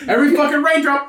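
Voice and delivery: usual speaking voice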